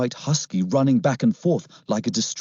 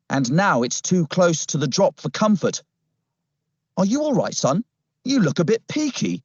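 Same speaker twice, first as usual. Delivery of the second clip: high-pitched officer character; put-on policeman voice; 5 semitones higher than usual. Policeman checks on the boy by the cliff, put-on officer voice. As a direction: play the policeman, well above the narrating voice.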